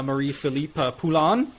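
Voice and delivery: put-on the name said lightly in a French accent; a playful hint of a French Canadian accent on the name. Podcast host playfully names the player, lightly French accent. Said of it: "That's my French accent".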